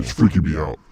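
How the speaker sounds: a big demon proudly touring hell; low loud demon. Pretend. Same demon voice continues.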